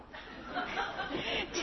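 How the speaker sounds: soft unvoiced laugh; quiet airy laugh; breathless laugh. Real, not acted. Laughing at the memory.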